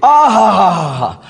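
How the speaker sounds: long drawn-out "aah" of delight; mock swooning sigh. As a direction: swoon in exaggerated bliss, stretched out. Mock swooning at their English. (बनावटी आह भरते हुए)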